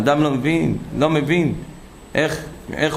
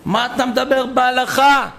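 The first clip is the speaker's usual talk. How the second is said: humiliating rebuke; 10 semitones higher than usual. Retold or imitated. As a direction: put him down in front of everyone. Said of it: "ביזה אותו לפני כולם".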